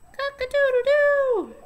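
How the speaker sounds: high, loud rooster crow. Shown as a demonstration. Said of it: "What does the rooster say?".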